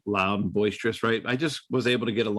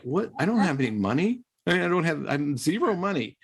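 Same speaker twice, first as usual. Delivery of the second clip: higher-pitched, taken-aback protest; retold disbelief; 7 semitones higher than usual. Rookie stuck with the team's bar bill, incredulous. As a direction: act out a rookie's startled objection.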